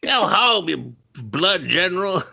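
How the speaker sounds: high mock heckle; mock movie line. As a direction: sneer it in a high voice. Mocking a movie scene.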